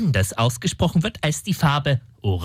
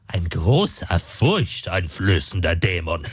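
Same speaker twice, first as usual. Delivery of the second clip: much lower, slow and ominous; menacing demon boast; 10 semitones lower, slower than usual. Acted. Demon tries to frighten Heinrich, deep slow boast.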